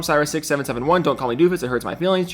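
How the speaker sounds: normal talking voice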